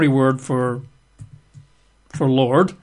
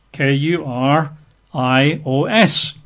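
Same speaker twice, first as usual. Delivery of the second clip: ordinary delivery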